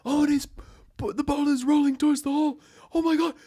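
frantic play-by-play rising to a yell; soccer commentator. Retold or imitated. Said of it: "South American soccer commentators switching roles".

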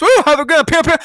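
high, loud whooping cowboy parody; Wild West gunslinger. Acted. Host mocking people treating Tennessee as Wild West, whooping gunslinger. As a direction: whoop and fire off mock gunshots.

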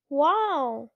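mildly excited exclamation; a touch of wowed enthusiasm; excited cry. A narrator's reading. Luke admiring the monster, mildly excited cry. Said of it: "he cried".

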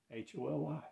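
H O L Y spelled letter by letter, soft; softly spelling out H O L Y. Shown as a demonstration. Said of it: "the holy".

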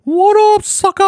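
high piercing shouted greeting; annoying squeal. Acted. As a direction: shriek it as annoying as possible. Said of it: "the most annoying sound ever".

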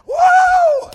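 high, loud joyful holler; ecstatic woo. Real, not acted. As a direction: let out a piercing woo.

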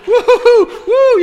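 very high, loud laughing hoots; joyful whooping. Retold or imitated. Healed woman dancing a jig, very high loud joyful hoots. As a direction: hoot with glee while dancing a jig. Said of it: "you know, like that".

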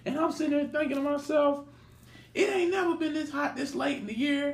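lightly put-on motherly voice, high and soft; mama's voice. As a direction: recount it as mama, pitched well up. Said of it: "my mama was talking about that".

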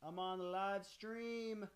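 high, drawn-out distant call. Real, not acted. Host to kids at the door during live stream, calling out. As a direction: call slowly across the house, off-mic. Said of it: "Let me just tell them I'm busy right now".

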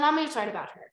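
usual speaking voice

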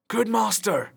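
a yeoman hails his master; lightly voiced eager yeoman; Will crying out. A narrator's reading. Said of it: "cried Will".

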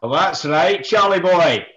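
loud put-on Scottish accent. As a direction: open with a loud mock-Scottish greeting. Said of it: "promised to do it in the Scottish accent".

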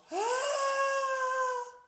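very high long straining gasp; deepest breath in. A narrator's reading. Little tiger breathing deepest for the doctor, long gasp. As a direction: gasp in as far as you can. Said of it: "Noch tiefer".

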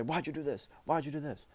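lightly acted, half-yelled demand; mock parental scolding. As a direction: mimic a parent getting on a kid's case. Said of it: "you gotta say".

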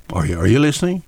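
ordinary talk, nothing marked

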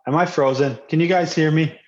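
usual speaking voice

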